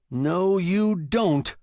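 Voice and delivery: slow, loud, high shout. A narrator's reading. Customer stopping the fleeing shop owner, slow shout. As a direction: shout to stop someone leaving. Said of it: "I shouted".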